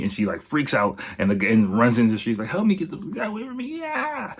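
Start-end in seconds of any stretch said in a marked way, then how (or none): 2.5-4.3 much higher, hammed-up panicked cry for help; mock panicked scream. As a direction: play her fleeing in mock terror, pitched way up. Acted woman fleeing down street.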